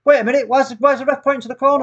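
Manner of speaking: high, loud sudden alarm; surprised. Real, not acted. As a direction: shout in disbelief. Sudden doubt after goal.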